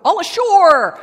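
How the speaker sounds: loud high call of All ashore; shouted call. Acted. The ship's men shouting to land at the dock. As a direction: bellow it like sailors reaching port. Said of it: "the men shouted".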